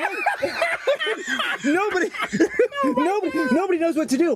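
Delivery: high, laughing through the words; helpless laughter. Real, not acted. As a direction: stammer it out while cracking up. Cracking up while telling the story.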